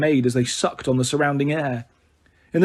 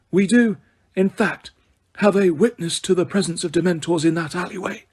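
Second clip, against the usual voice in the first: Dumbledore; 4 semitones higher than usual. A narrator's reading. Dumbledore voiced, calm.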